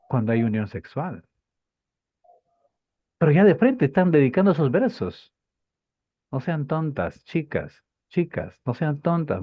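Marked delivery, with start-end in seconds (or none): 3.2-5.3 rising, loud and fast in real excitement; getting swept up and speeding into a louder pitch; carried away. Real, not acted. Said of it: "Me emocioné". (emocionado de verdad, más agudo, fuerte y rápido)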